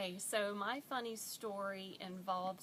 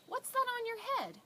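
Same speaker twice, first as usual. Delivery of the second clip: high, louder imitation of a child; nosy three-year-old; 6 dB louder, faster than usual. Three-year-old asking about a hairnet, high loud child voice. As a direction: blurt it as an unshy little kid. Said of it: "my three-year-old, who is far from shy".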